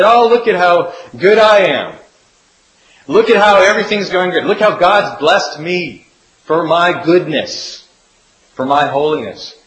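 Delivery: lightly hammed-up smug religious leader; smug self-righteous. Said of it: "looked at their own righteousness and said".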